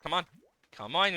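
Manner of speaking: ordinary talk, nothing marked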